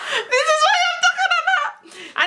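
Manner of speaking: high squeal through laughter; real giggly, delighted squeal; laughing exclamation. Real, not acted. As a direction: crack up while saying it. Delighted aside after thanking Jesus.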